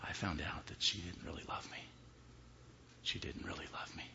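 low whispered menace; creepy whisper. Acted. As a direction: breathe it out coldly. Husband's creepy confession.

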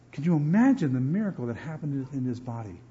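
normal talking voice

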